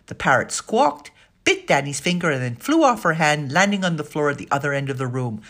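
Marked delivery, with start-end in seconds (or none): none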